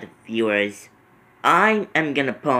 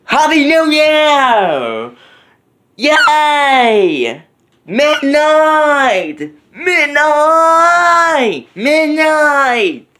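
excited shout; 12 semitones higher, 6 dB louder, much slower than usual. Real, not acted. Celebrating midnight.

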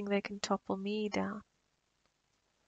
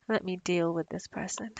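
ordinary delivery

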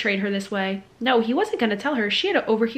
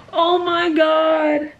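stunned; 5 semitones higher, 4 dB louder, much slower than usual. Real, not acted. Shocked reaction to reveal.

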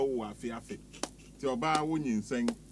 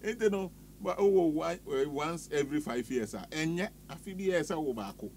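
playful preacher-style blessing, a little louder; mock prayer; 5 semitones higher, 3 dB louder than usual. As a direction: pronounce a blessing like a pastor, half joking. Mock blessing prayer over listener.

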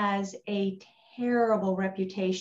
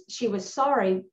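ordinary talk, nothing marked

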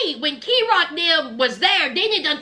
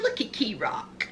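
soft mock whisper of awe; breathy voiceless aside; 7 semitones lower, 10 dB quieter than usual. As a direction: hush it like it's precious. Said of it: "I said".

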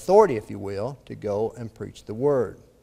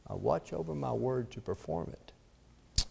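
much softer, measured echo of the verse; hushed repetition; 10 dB quieter than usual. Acted. Preacher repeating God's word to Jeremiah, hushed and deliberate. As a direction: let it sink in quietly.

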